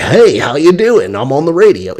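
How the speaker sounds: radio guy voice. Retold or imitated. Son likening dad to a radio host, over-the-top voice. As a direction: draw out a hammed-up DJ hello. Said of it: "one of those guys on the radio".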